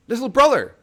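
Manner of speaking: high, loud call across the snow; crying out. Acted. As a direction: call out desperately into the dark. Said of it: "he cried".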